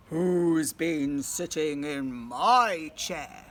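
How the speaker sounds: very slow, low, loud bear voice; deep dramatic papa bear; Daddy Bear. Acted. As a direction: boom it out slowly like a big bear. Daddy Bear's big line.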